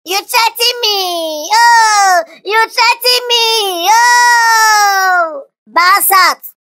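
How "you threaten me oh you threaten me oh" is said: slow, drawn-out wailing indignation; indignant outcry. Acted. Champion answers a threat, drawn-out indignant outcry. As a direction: stretch every cry in offended disbelief.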